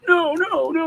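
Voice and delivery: high, loud, whining no's; mock whiny protest. Imitating his kid protesting about the ringtones.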